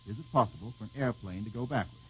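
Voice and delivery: re-read the question quietly to the panel, "possible" stressed and falling. Shown as a demonstration. Quiz host repeats the listener's question to the panel, softly.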